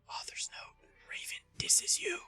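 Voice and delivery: whispering the author's note under her breath; hushed, breathy aside to the chat; whispered aside. Acted. Streamer reading an author's note aside, whispered.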